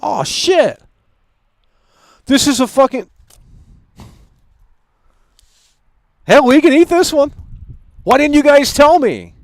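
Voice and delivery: suddenly delighted by the food; pleasant surprise. Real, not acted. Streamer surprised the trolls sent a good pizza.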